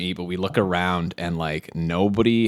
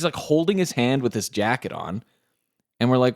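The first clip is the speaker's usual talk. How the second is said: ordinary talk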